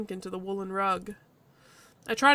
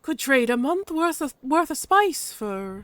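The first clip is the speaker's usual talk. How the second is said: higher-pitched Irish-tinged badger; vaguely Irish accent; 5 semitones higher, 4 dB louder, slower than usual. Badger sizes up the heater, vaguely Irish. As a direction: haggle in a loose Irish accent. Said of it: "the badger's vaguely Irish".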